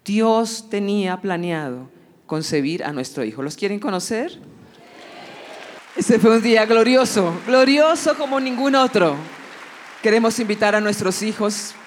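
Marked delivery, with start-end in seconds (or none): none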